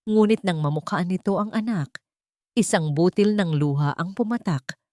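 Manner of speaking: ordinary talk, nothing marked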